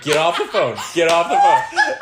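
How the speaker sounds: mock-yell at dad, laughing; family yelling at dad to hang up; urgent yell.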